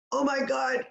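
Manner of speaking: gasp, then high mock panic; nervous, breath held. Coach acting out nerves on a call, panicky. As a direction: suck in air and talk on held breath. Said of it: "hold your breath if you're nervous".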